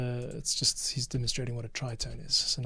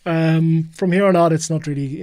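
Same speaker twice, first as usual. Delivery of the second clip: louder; 4 semitones higher, 11 dB louder than usual. Real, not acted. Editor wraps up loudly.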